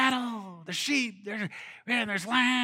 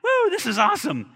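higher and louder whoop of delight; excited whoop; 7 semitones higher, 6 dB louder than usual. Retold or imitated. Believer celebrating the blessing, excited whoop. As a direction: cheer like you just got rich.

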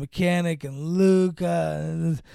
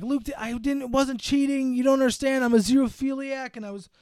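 mock pleading; 7 semitones higher than usual. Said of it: "he's like".

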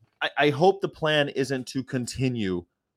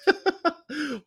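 high laugh. Real, not acted. Laughing mid joke.

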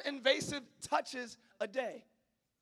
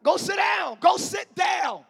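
loud, higher shout of go sit down, the second slow; parent's yell; 7 semitones higher, 13 dB louder, slower than usual. Acted. Fed-up parent yelling at the kids to sit down, loud shout.

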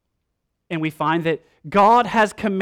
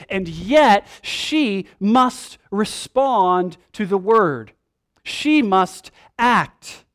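every word slow and hammered, louder; slow emphatic preaching; 2 semitones higher, 4 dB louder, much slower than usual. Acted. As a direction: drive home she must act. Preacher drives home the point.

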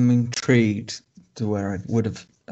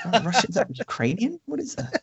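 mock clueless question; 10 semitones higher, 5 dB quieter than usual. S1 parodies the character.

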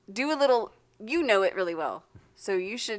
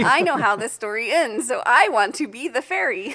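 raised, emphatic claim; loud insistence; 2 semitones higher, 8 dB louder than usual. Real, not acted. Listener claims the fairy role from the group, loudly insistent. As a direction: press the point over the others, loudly.

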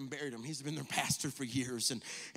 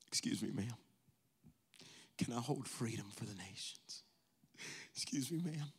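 preaching build; 2 semitones higher, 15 dB louder than usual. Acted. Preacher building the pastor's plea to his church, from hush to loud. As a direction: start hushed, climb to a roar.

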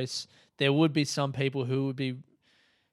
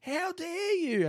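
very high-pitched mock outrage; re-enacted hysterical indignant rant; going off on her; slower than usual. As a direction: squeal with comic fury, stretching the last words. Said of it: "I just went off on her".

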